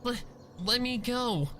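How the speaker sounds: high, frightened stammer. A narrator's reading. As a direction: stumble over the words in panic. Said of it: "she stammered".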